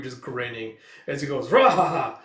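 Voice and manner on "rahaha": loud belly laugh, rahaha; hearty booming laugh. Acted. Kuroku bursts in laughing loudly with his news. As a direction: laugh it out big and proud.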